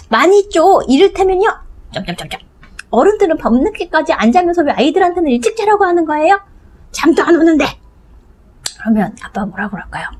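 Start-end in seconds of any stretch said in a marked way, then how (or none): 0.1-7.6 put-on child's voice. Child listing complaints to dad, high and insistent. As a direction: list the grudges like an indignant kid.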